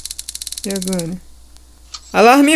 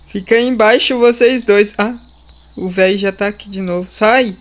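ordinary delivery

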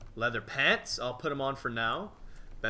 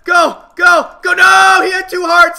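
high, loud frantic yelling; excited shouting; 17 dB louder than usual. Real, not acted. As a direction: scream at the screen in the fight. Cheering on a fight, then losing.

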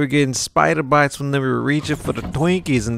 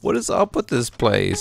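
incredulous; 7 semitones higher, 3 dB quieter than usual. Real, not acted. Exasperated at spiders everywhere.

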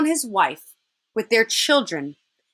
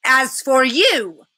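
slow, louder witch's threat; menacing witch; 3 semitones higher, 5 dB louder, much slower than usual. Acted. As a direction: turn on the boy, drawing out each word. Witch turns on Edmund.

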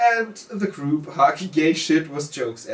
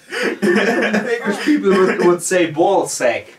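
giggling through the words, high and loud; can't stop laughing while saying it; laughing fit mid-sentence; 7 semitones higher, 5 dB louder, slower than usual. Real, not acted. Laughing fit over ball sack.

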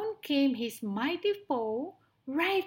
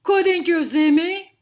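very loud, raised indignant voice; growling lion; 7 semitones higher, 15 dB louder than usual. Acted. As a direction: demand it as an outraged lion. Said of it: "growled Lionel".

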